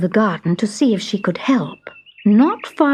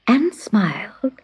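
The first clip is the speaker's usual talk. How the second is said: ordinary delivery, nothing marked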